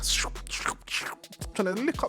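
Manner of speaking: high, cracking with laughter; giggling at his own story; laughing. Real, not acted. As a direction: laugh through the words.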